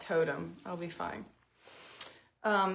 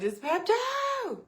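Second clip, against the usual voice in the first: pained plea; 14 semitones higher, 3 dB louder, slower than usual. Retold or imitated. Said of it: "I'm like".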